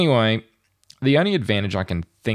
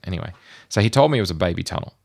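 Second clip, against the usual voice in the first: ordinary talk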